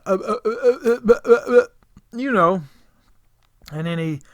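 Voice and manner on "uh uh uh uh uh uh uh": rapid stuck stammering uhs; imitated stutter. Imitating nervous helper at McDonald's, stuttering. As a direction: stutter on one syllable, unable to get going. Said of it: "He started stuttering. He went".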